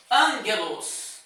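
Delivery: ângelos, gamma sounded as N; right reading. Shown as a demonstration. Teacher giving the right reading ângelos, nasal N. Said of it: "não vou dizer aguelos na pronúncia".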